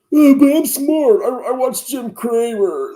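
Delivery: slow, high, loud mocking voice of a smug investor; smug know-it-all. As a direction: sneer it out like a fake genius who watches TV stock tips. Mocking a self-styled smart investor.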